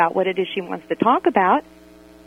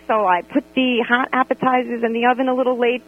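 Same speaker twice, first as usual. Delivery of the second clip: normal talking voice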